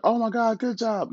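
high, gushing mock praise; the proud parent he refuses to be, squealing. Father sneering at a doting parent, high mock gush. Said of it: "I was never like".

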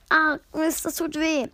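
mildly hurt, after the blow; in pain. A narrator's reading. Megatron, knocked away by the tree, complains mildly in pain. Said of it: "Megatron sagte gekühlt".

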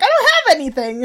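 high, loud, quick excuse; mock protest. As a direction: reenact a helpless protest to a therapist. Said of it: "I'm like".

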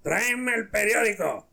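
put-on high, slow drunken call; comic drunk giving an order; drunk duck owner. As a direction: call out to the duck in a drawn-out slur. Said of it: "el que había dicho que tenía el pato dice".

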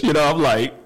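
ordinary talk

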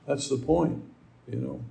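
ordinary talk

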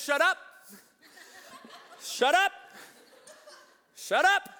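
loud, high comic outburst; mock scolding command. Jesus comically silencing wind and waves, loud mock command. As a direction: punch it out sharply, rising in pitch.

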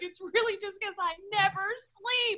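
wife imitating husband's exhausted complaint; mock husband whine. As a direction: play the frazzled husband dramatically. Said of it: "And he's like".

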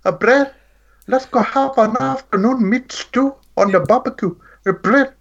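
bad South African accent, fishing for a word. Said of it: "my South African accent".

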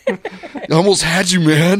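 high, laughing mock movie-racer voice; breathless Paul Walker. Host mimicking a racer's famous line, mock breathless excitement. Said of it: "starts breathing all hard".

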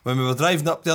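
ordinary talk

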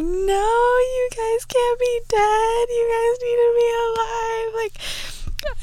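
very high, whimpering plea with a crying laugh; hammed-up teary wail; mock sob plea. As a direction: beg for Rocket to be okay, half-crying. Retold plea to dead characters.

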